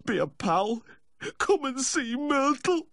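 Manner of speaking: very high, wailing plea; weepy, slow, high-pitched entreaty; tearful begging. A narrator's reading. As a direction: coax pitifully, drawing the words out. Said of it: "the distraught weeping".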